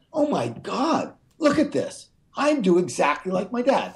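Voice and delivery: high-pitched "oh my God" moment; retold stunned realization. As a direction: relive the sudden realization, pitch way up. Retelling his sudden shocked realization.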